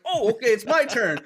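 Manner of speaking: high-pitched, acted-out startled reply; startled reaction. Hunter answering his buddy's call to shoot, mock startled. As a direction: replay being suddenly put on the spot.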